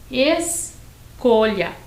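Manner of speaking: "escolha" slowly, ES, pause, COLHA with palatal LH; LH sound. Shown as a demonstration. Teacher shows escola transformed into a new word, LH sound. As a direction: split "escolha" after ES, LH like Italian gli. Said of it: "Transformei a palavra escola".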